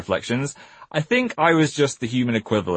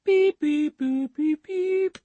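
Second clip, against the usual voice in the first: robot beeps; much slower than usual. Pretend. Robotic time machine beeps.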